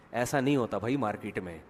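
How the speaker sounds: ordinary talk, nothing marked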